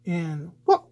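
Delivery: a hint of Mario's high wahoo; lightly voiced Mario exclamations. Shown as a demonstration. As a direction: toss off the little Mario yells lightly. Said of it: "little wahoo".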